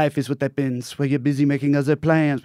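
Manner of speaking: put-on French accent, lightly done. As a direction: say it like a French speaker. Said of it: "in a French accent".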